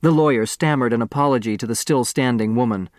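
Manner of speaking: usual speaking voice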